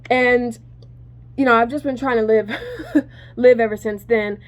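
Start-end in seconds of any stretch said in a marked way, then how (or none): 2.5-3.0 quick high burst of laughter; short high laugh. Real, not acted. Brief laugh mid-sentence.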